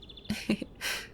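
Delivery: soft, airy giggle; quiet laugh at their expense; light, mischievous chuckle; teasing giggle. Acted. Teasing about the pillow.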